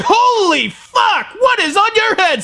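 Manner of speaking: normal talking voice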